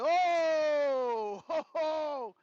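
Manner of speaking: high nervous laugh, caught off guard; scared laugh. Retold or imitated. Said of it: "I said".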